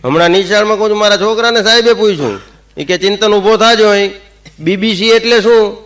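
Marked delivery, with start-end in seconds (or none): 3.0-5.8 teacher. Retold or imitated. Teacher questions pupil in class.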